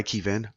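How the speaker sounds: Kevin said "KEE-vin"; unusual name pronunciation. Acted. Apologising to the man who corrected it, long-EE pronunciation. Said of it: "it's pronounced Kevin".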